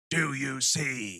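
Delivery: slow, drawn-out villain taunt; Sam Neill. Retold or imitated. Eyeless Sam Neill taunts Fishburne, mock menacing. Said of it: "Sam Neill without his eyeballs and shit is yelling".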